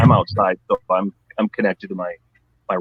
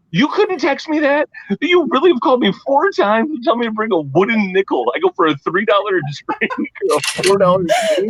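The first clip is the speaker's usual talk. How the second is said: incredulous rant; 11 semitones higher than usual. Retold or imitated. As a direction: pitch way up in disbelief. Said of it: "mocking caller mid-story".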